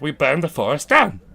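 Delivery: low mock English butler; Michael Caine's Alfred. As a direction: imitate the old butler's confession. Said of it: "Alfred's literally, like, pouring his heart out".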